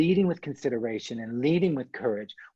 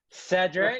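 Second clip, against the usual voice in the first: shouted name; 6 semitones higher, 3 dB louder than usual. Shown as a demonstration. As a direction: call the name out, higher and louder. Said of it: "Anyone, you can shout it".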